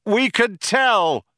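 high, slow, stretched-out retort; Ford shouting back. A narrator's reading. Ford retorts to the cop, shouting. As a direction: call it back drawn out. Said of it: "shouted Ford".

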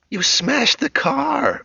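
very high, slow shocked cry; shocked shout. Acted. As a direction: shout it almost like a question. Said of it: "Becky shouted".

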